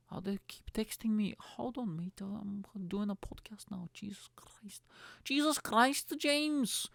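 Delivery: high-pitched, put-on Scouse whine; Scouse accent. Podcaster moaning at a friend texting mid-podcast, hammed-up Scouse accent. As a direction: complain in a high mock Scouse voice. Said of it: "This is a Scouse accent".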